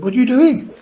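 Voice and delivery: high-voiced retold astonishment; startled mother. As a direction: imitate a mother's startled, high-pitched question. Alarmed mother voiced in the story.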